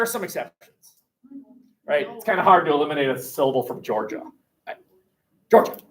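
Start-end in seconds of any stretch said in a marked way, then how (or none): none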